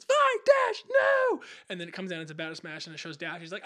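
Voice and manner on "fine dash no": much higher, loud retold shout; character's shout. Violet screaming to Dash as the robot falls, loud and high. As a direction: yell out to her brother in danger. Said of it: "And then she goes".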